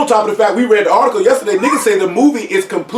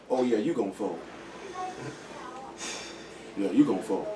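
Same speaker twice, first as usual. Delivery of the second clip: low dismissive verdict; 10 semitones lower, 17 dB quieter than usual. Real, not acted.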